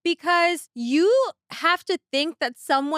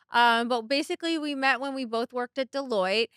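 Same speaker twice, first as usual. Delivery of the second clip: usual speaking voice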